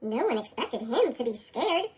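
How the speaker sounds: usual speaking voice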